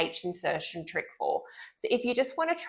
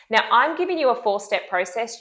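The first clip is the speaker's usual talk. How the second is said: normal talking voice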